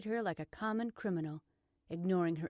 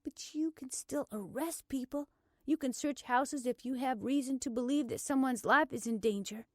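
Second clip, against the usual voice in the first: higher, restrained urgency; trying to calm down; 6 semitones higher, 3 dB louder than usual. A narrator's reading. Blair appealing to the officer, straining for calm. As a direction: reason with him, holding frustration in. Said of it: "she tried to calm down".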